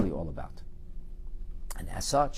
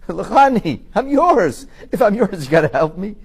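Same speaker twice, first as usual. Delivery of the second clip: raised, urgent plea to God; loud pleading; 8 semitones higher, 10 dB louder, slower than usual. Pretend. A person begging God aloud for help, loud pleading.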